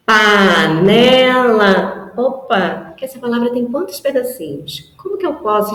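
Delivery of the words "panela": very slow, each syllable apart; syllabified demo. Shown as a demonstration. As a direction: stretch the word into syllables. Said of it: "é perceber que".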